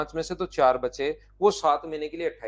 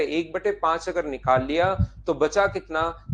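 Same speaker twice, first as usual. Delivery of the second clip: ordinary talk, nothing marked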